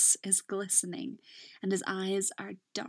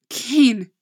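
crying out; 3 semitones higher, 12 dB louder than usual. Acted. Crying out lover's name.

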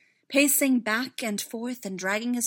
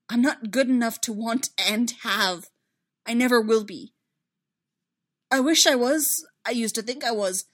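loud, raised-pitch self-reproach; anguished regret; 4 semitones higher, 10 dB louder than usual. A narrator's reading. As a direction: pour out regret with rising force. Anguished confession of unworthiness.

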